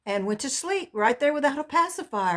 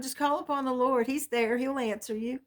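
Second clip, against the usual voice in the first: ordinary talk, nothing marked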